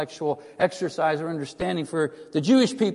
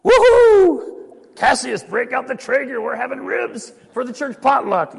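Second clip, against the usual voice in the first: very high, loud whooping cheer; joyful Roman whoop; 8 semitones higher, 9 dB louder than usual. Retold or imitated. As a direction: celebrate like a Roman told ribs are clean. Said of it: "Imagine a Roman hearing that".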